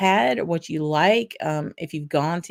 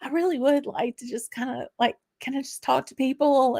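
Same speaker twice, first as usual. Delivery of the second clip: high, softened Southern-charm plea; 8 semitones higher than usual. Pretend. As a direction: sweet-talk your way in, pitched up. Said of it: "try to turn on the Southern charm".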